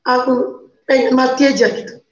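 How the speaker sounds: usual speaking voice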